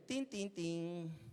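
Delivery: slow "Tin, tin, tin" like a doorbell; doorbell imitation. Imitating the doorbell at seven in the morning, slow chime. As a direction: ring it out slowly. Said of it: "el timbre".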